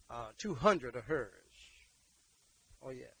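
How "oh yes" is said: ordinary talk, nothing marked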